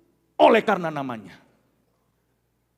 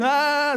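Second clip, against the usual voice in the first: loud, high drawn-out mock cry; imitated wail; 8 semitones higher, 8 dB louder than usual. Father imitates his crying child running through mall, mock wailing.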